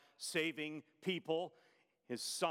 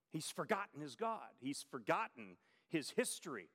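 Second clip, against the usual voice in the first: usual speaking voice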